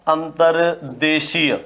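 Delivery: अंतर्देशीय spoken slowly, syllable by syllable; slow correct pronunciation. Shown as a demonstration. Teacher showing correct form, slow model word. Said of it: "कैसे लिखा जाएगा".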